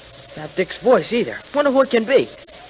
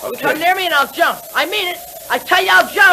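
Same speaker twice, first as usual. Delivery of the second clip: high, rising desperate warning; cornered boy warning the men off; panicked yell; frightened threat; 11 semitones higher, 3 dB louder than usual. Acted. Kid threatens captors at the edge.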